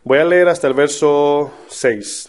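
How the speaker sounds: usual speaking voice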